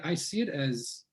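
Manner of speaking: ordinary talk, nothing marked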